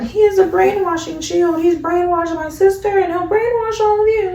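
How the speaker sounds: high, mocking imitation of the older sister; mock villain voice. Retold accusation by Shorty Doo-Wop.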